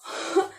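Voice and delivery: short burst of laughter; amused laugh. Real, not acted. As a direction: laugh at the memory. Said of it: "मेरे को इतनी हंसी आई ना". (हल्की सी हंसी)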